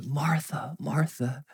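soft whispered tender call; tender, compassionate. Retold or imitated. Jesus to anxious Martha, tender whisper. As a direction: call her name gently, with love. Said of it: "out of deep love and compassion".

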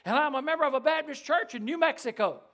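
loud, high proclamation, stretching "saved"; eager, forthright. Retold or imitated. Old man announcing his church membership, right out there with it. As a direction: imitate a man boldly testifying right out. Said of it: "just kinda right out there with it".

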